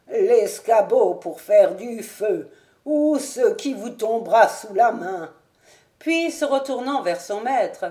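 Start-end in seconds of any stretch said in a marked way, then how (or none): none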